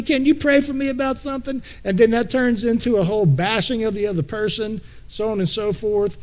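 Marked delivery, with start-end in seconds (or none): 0.0-1.6 mock sweet request. Preacher mocking a churchgoer who fakes a prayer request to gossip. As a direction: ask for prayer in a falsely sweet, higher voice.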